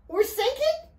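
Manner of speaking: very high, disbelieving question, rising at end; alarmed cry. A narrator's reading. Riley learns the boat is flooding, alarmed cry. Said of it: "Riley cried".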